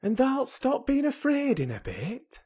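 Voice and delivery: high, cheerful Yorkshire lilt; cheerfully reassure, pitched well up; renewed cheer. A narrator's reading. Dickon promising the fear will pass, renewed cheer. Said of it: "Dickon said with renewed cheer".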